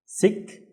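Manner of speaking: SIK alone, cut off on the k; isolated "sik"; syllable demo. Shown as a demonstration. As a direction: say "sik" by itself with its closing k. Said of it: "also need to pronounce the k".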